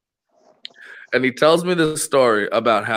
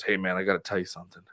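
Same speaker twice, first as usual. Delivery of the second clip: quick, low worried mutter; hurried, hushed confession; stressed and hushed; 6 semitones lower, 11 dB quieter, faster than usual. Retold or imitated. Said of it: "he's kinda stressed out about it".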